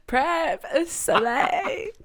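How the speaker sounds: high, drawn-out mock diva delivery; mock slay impression. Teasing a friend for how they said prep, hammed-up impression. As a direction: imitate a friend, high and exaggerated. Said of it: "you were doing it like".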